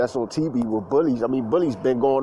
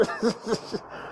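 quiet, breath-only laugh; soft chuckle; 7 semitones higher, 8 dB quieter than usual. Real, not acted. Speaker joking about work clowns, soft chuckle. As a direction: laugh softly at your own joke.

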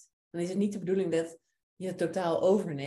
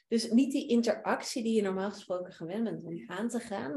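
usual speaking voice

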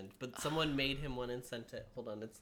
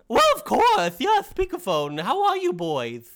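very high loud cartoon king; King Candy; 10 semitones higher, 16 dB louder than usual. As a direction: greet them grandly in character. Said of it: "I sound like the Mad Hatter".

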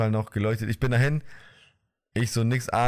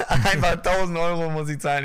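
higher, laughing through the words; laughing in disbelief; 6 semitones higher, 4 dB louder than usual. Real, not acted. Telling friend about the huge garage bill, laughing in disbelief. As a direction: laugh at the absurd bill as you say it. Shocked at thousand euro bill.

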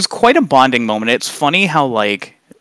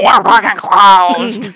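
louder drawn-out angry refusal; slow aggressive growl; 7 semitones higher, 5 dB louder, much slower than usual. Retold or imitated. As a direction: drag out every word with venom. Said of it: "they all look at me and go".

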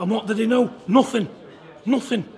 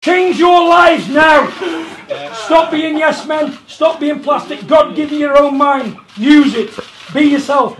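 preaching; 5 semitones higher, 8 dB louder than usual. Acted. Preaches to listeners.

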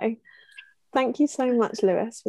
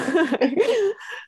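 high laugh; 11 semitones higher, 4 dB louder than usual. Real, not acted. Laughs while wrapping up episode.

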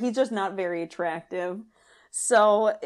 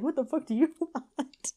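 mock irritated sergeant; 7 semitones higher than usual. Mimicking the brusque sergeant's retort.

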